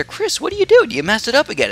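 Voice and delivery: high squeaky mock complaint; imitating viewer. Another fan complaining to Chris, squeaky mock voice. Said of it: "And then another person".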